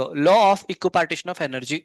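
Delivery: drawn-out heading read for notes; slow dictation. Shown as a demonstration. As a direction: stretch each word for the class to copy. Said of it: "आगे लिखो".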